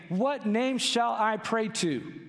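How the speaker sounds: slow, high pleading question; a touch of desperate pleading; pleading cry. Retold or imitated. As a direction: voice the lost crying out for God. Imagined sailors crying out.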